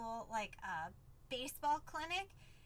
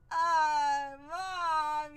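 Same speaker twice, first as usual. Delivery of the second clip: loud imitated wail to mom; crying child; 2 semitones higher, 9 dB louder than usual. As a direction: drag out an upset kid's complaint. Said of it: "and she started crying".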